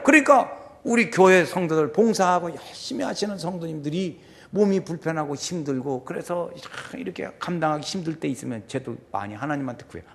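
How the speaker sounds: normal talking voice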